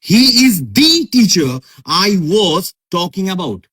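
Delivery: slow sentence, punching "the" as "DEE"; emphatic THE. Shown as a demonstration. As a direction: lean hard on "the", drawn out as "dee". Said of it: "জোর দিয়ে বলা".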